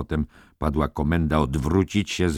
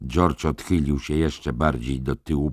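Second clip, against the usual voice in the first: ordinary delivery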